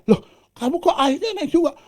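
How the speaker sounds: high, loud astonished cry; high-pitched mock disbelief; surprised. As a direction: gasp at the frog's success. Surprised frogs voiced.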